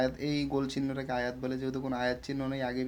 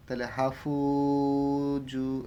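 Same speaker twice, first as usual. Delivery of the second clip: slow restart on হাফুজু, joined to the letter before; slow Quranic restart; resuming after breath; much slower than usual. Shown as a demonstration. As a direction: pick up again from হা, drawn out. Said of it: "এই, এরকম পড়তে হয়".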